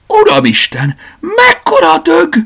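high, slow shriek of alarm. Acted. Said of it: "sikított fel". (rémült, magas sikoltás)

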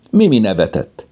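usual speaking voice

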